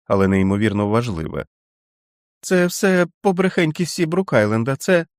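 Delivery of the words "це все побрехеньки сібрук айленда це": put-on frightened child. Feverish boy child voice.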